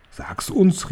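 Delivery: high, eager plea without volume; bittender Zuruf. A narrator's reading. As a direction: call out as begging witches, lightly. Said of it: "riefen sie". (hoher, drängender Zuruf)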